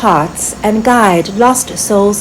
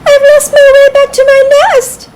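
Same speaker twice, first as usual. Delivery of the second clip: high squeaky squirrel voice, a touch forlorn; a little lost squirrel sighing it out; high squirrel voice with a hint of sorrow; sad squirrel; 5 dB louder than usual. A narrator's reading. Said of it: "The squirrel sighed".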